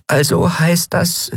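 a little winged creature asking in awe; high, delicate fairy voice; tiny fairy voice. Acted. Fairy Nelen speaks.

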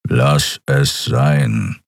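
low, very slow growl. A narrator's reading. Keru growls at Endriel to drop it, low and slow. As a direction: warn them off with a growl. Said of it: "knurrte er".

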